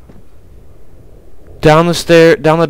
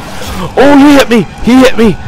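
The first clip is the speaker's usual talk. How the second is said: high, loud screams under attack; panicked screaming; 6 semitones higher, 3 dB louder than usual. Real, not acted. Player being hit by the zombie, panicked screaming.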